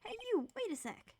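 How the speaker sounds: high, loud shout after someone; urgent acted holler; calling out. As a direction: yell to stop someone running off. Calling after a fleeing boy.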